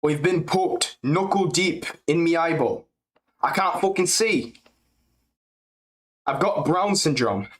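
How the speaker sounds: Tom Aspinall impression. Streamer inventing fighter quote, light English accent. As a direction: whine about an eye poke in an English accent. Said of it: "I can do a decent Tom Espinal".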